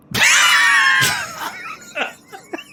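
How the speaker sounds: loud high hooting laugh; booming orc laugh. Acted. Orc bursts out laughing at the adventurer's broken speech, booming laugh.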